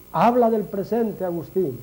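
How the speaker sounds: ordinary talk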